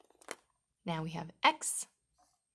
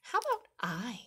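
whispering; 4 semitones higher than usual. Acted. Playful whisper to the children.

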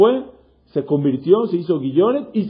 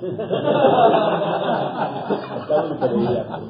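amused laugh. Real, not acted. Class laughs at spitting joke.

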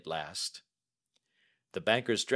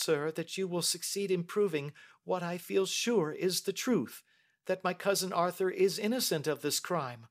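put-on high, earnest young lady; Mary; 10 semitones higher than usual. Young lady telling the detective her cousin is innocent, earnest. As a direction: appeal politely in a high-pitched voice.

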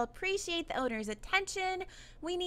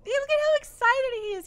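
excited delight; 9 semitones higher, 6 dB louder than usual. Real, not acted. Amused by eager employee.

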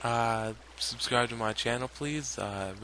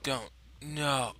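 ordinary talk

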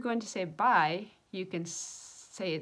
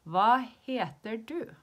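very slow, word-by-word question, higher and louder; slow teaching model; 4 semitones higher, 7 dB louder, slower than usual. Shown as a demonstration. Teacher showing how to ask someone's name, slow demonstration. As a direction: spell it out slowly for learners.

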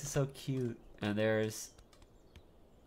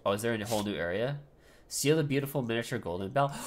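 usual speaking voice

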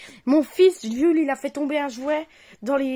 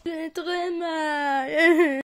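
exaggerated whimper; 2 semitones higher, much slower than usual. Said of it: "il exagère".

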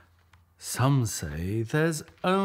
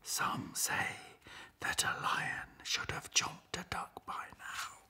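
whisper; 10 dB quieter than usual. A narrator's reading. Narrator whispers aside.

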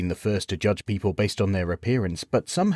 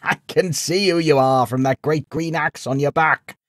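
put-on high old man, teasing with laughs; good-natured laughing old man; 7 semitones higher, 3 dB louder than usual. A narrator's reading. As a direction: rib him with a laugh. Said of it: "burst out in good-natured laughter".

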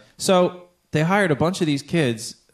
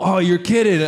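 higher-pitched retold disbelief; incredulous; 6 semitones higher, 4 dB louder than usual. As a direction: react as if you can't believe it. Retelling own shock at the news.

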